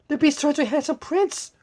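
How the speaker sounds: high, loud excited retelling. Real, not acted. Reader thrilled by the prince reveal, high and loud. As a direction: burst out with delight.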